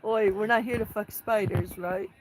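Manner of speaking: loud mock Australian, bold and cheeky; hammed-up Aussie bloke voice; Aussie accent. Streamer tries an Aussie accent for chat, loud mock Australian. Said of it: "I should try my best Aussie accent".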